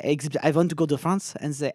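ordinary talk

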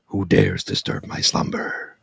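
very low, slow booming cave voice; grinding stone statue. Retold or imitated. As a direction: rumble it out like a giant stone head. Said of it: "an Aladdin reference".

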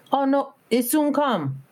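high, slow, easygoing Jamaican lilt; Jamaican lady. Retold or imitated. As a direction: lay back like a Jamaican lady. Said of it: "She was standing there".

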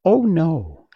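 high, loud mouse queen; a hint of a squeak, emphatic; slightly squeaky loud denial; squeaky little voice. A narrator's reading. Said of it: "in her squeaky little voice".

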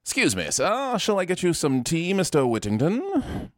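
hammed-up British accent, drawing out the final name; mock British accent. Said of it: "do a British accent".

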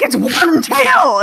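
mock angry realization. Friend mocking her reaction to the wrong name, drawn out and high. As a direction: stretch it out like an indignant shout. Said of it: "you were just like".

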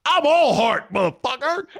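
hammed-up Rod Tidwell bravado. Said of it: "you should have gone all Rod Tidwell on her".

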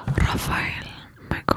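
whispered, scandalized reaction; hushed, breath-only dismay; shocked whisper. Real, not acted. Shocked reaction to crude joke.

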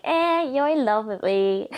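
playfully smug shrug in the voice; carefree, guard down. Acted. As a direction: drawl it like you needn't bother anymore. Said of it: "let your guard down".

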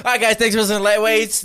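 loud, fast host sign-off; podcast outro. Acted. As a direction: wrap up the show brightly.

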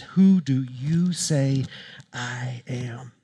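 very slow, drawn-out words falling to a hush; solemn, deliberate question. Retold or imitated. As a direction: ask it slowly and weightily, softening at the end. Jesus questioning disciples face to face.

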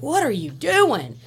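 mock a startled guard pulling her back; imitating the officer. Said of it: "and he's like".

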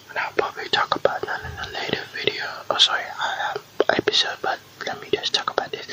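quick hushed whisper; quick whispered correction to the viewers; whispered aside. Real, not acted. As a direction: mutter it fast under your breath.

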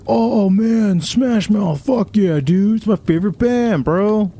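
high-pitched gushing fan parody; mock fan excitement. Pretending to spot a band's singer, high mock excitement. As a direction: gush high all through like a starstruck bro.

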